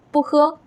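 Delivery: 不喝 with 不 not rising; original tone on 不. Shown as a demonstration. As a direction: keep 不 unchanged before a high 喝. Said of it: "不 keeps its original first tone".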